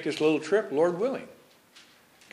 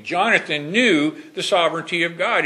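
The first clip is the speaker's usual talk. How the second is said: normal talking voice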